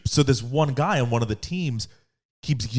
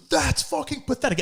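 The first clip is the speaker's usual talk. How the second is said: high, sneering heckler voice; mock the angry little guy; whiny mock heckler; 12 semitones higher than usual. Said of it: "he was like".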